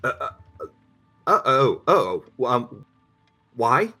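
alarmed, stammering uh-oh; nervous stuttering lawyer. Acted. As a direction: caught off guard, stumbling. Lawyer reacts to the Beast.

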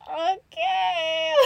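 high, drawn-out "okay"; startled reaction. Retold or imitated. Retelling her sighting of Spirit, startled and drawn out. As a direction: stretch it out high, playfully acted.

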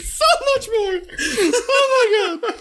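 high laughing yes; laughing squeal. Real, not acted. Agreeing with the co-host, squealing high with laughter.

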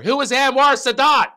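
high, loud mock-mom yell; hammed-up distant mother's shout; mother shouting answer. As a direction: call out a quiz answer as an imitated mother. Mother yelling Jeopardy answer.

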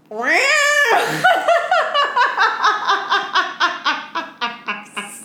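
shrieking with laughter; helpless high-pitched laugh; long high laughter. Real, not acted. Cohost breaks up at the bear sound, howling laughter.

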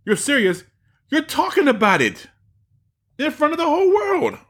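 mocking Joe Rogan. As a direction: play the stunned podcaster, pitch way up. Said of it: "he's like".

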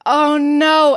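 usual speaking voice

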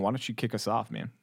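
usual speaking voice

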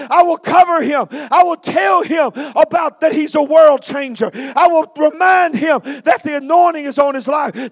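loud, high fervent vow at full force; impassioned preaching. Acted. Preacher crying out Hannah's vow to God. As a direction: preach it like a desperate mother's promise.

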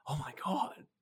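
breathless whispered amazement; awed whisper. Retold or imitated. Client whispering in amazement after reaching the bananas. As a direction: whisper it as if stunned by the result.

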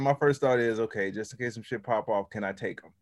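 ordinary talk, nothing marked

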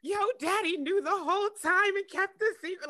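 high, imitation tearful wail; retold crying. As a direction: retell it as if crying over the reveal. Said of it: "I'm crying again 'cause I was like".

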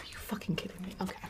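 hushed stunned whisper. Real, not acted. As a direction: whisper it in disbelief. Disbelieving whispered reaction.

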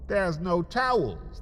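ordinary delivery

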